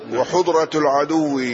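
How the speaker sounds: second vowelling, وحضرة with short u after ح; damma on the haa. Shown as a demonstration. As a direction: say وحضرة as wa-hudra, with "u". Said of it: "الحاء مثلثة". (وحضرة بضم الحاء)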